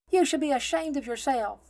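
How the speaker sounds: high, loud reproach; firm scolding. A narrator's reading. Nun rebukes the stranger, firm high scolding. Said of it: "she states firmly".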